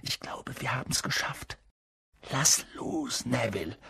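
very soft, secretive hush; whispering. A narrator's reading. Harry hiding with his friends, whispering. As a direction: keep it under your breath. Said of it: "flüsterte Harry".

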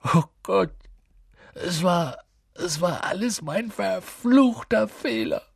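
high-pitched, acted sobbing; tearful, slowed lament. A narrator's reading. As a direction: wail it out in halting bursts of guilt. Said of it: "schluchzte er".

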